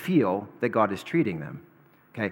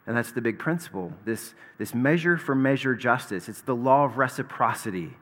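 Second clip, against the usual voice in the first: ordinary talk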